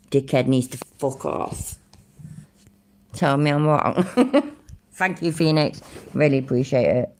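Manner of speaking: ordinary delivery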